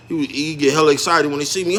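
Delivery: usual speaking voice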